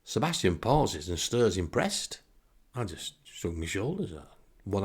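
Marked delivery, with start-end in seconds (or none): none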